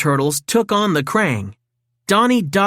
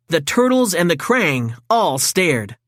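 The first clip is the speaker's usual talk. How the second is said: plain reading voice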